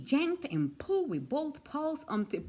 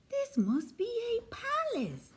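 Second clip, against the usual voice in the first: breathless, much higher whisper of amazement; awed gasp; 11 semitones higher than usual. A narrator's reading. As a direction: whisper it, stunned by the sight. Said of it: "Corduroy grasped".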